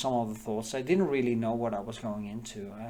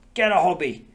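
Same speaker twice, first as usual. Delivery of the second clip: loud, higher-pitched mock order; exclaimed order; 6 semitones higher, 12 dB louder than usual. Girlfriend telling an idle man off, loud exclaimed order. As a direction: call it out as a sudden loud command.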